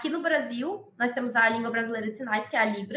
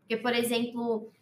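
ordinary talk

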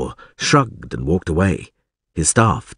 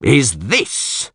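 higher, pointed question; accusing; 4 semitones higher than usual. A narrator's reading. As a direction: confront him over the bodies. Alderman accuses Witcher over bodies.

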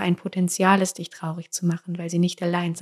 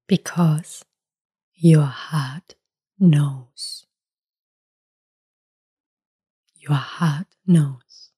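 slow, lower, weighty English; meaningful English; 3 semitones lower, much slower than usual. Acted. Speaker repeating to the listener that the heart knows. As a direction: let each word land, deliberately. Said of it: "switches to slow English".

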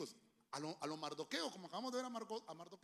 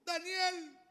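a cutting, raised king's call, slow; 8 semitones higher, 10 dB louder, slower than usual. Retold or imitated. King calling down into the pit for Daniel, cutting. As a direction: call him out sharply and loudly. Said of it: "con una voz cortante dijo".

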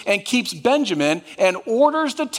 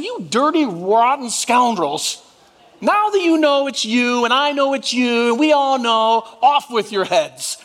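mock fury; 4 semitones higher than usual. Preacher imagining his own revenge on the brothers, mock fury. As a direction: play the vengeful brother, over the top. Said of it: "I would have said".

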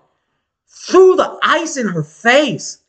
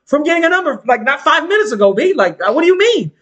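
incredulous; 6 semitones higher than usual. Retold or imitated. Mocking the dude.

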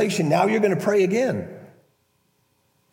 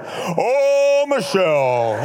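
mock pious intoning; 3 semitones higher, 3 dB louder, much slower than usual. Husband talking to Michelle like a prayer, mock pompous intoning. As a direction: address her as if praying grandly. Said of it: "that's not how I talk".

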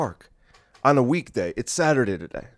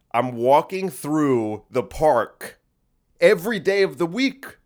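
slow emphatic; 3 semitones higher, 3 dB louder, slower than usual. Real, not acted. Slowed emphatic complaint.

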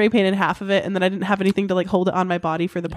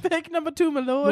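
mock servant voice; 7 semitones higher, 4 dB quieter than usual. Mock medieval servant joke.

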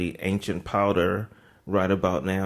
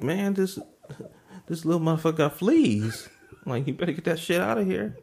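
much higher, fast, grossed out; high disgusted quote; 10 semitones higher than usual. Retold or imitated. Telling the owner to take the flea dog away, high disgusted. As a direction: squeal about the fleas.

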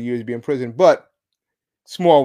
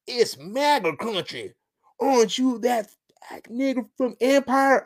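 higher, put-on heckler voice; mock attacker voice; 8 semitones higher than usual. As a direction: imitate a heckling attacker, pitched up. Acting out the attackers' shout.

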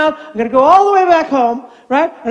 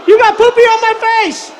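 put-on high, upset little-girl wail; hammed-up high kid tantrum; tearful granddaughter; 9 semitones higher, 4 dB louder than usual. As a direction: imitate a distraught child accusing Grandpa. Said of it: "and then she goes".

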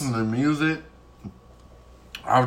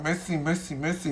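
mock French; 4 semitones higher, 7 dB quieter than usual. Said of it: "I be like".

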